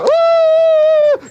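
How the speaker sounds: very high, loud whooping yell; wild whoop. Pretend. Playing the bad guy, whoops at the camera crew. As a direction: let out a crazy high whoop. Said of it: "You want me to be the bad guy?".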